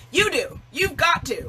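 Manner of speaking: high, insistent push; emphatic. Real, not acted. Coach urging viewers, emphatic and high. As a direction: press the point home hard.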